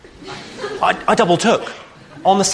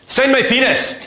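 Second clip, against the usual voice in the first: distant Scottish yell, high and loud; shouting from afar in a thick Scottish accent; 9 semitones higher, 4 dB louder, slower than usual. Retold or imitated. Drunk Scottish fan yells across the street in Scottish accent. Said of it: "thick Scottish accent".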